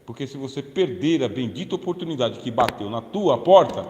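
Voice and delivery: normal talking voice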